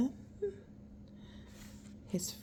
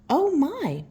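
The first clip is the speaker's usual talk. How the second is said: higher, louder startled wife; surprised Missus Claus; 8 semitones higher, 5 dB louder than usual. Acted. Missus Claus surprised at Santa's plan. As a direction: gasp it as a fussy wife.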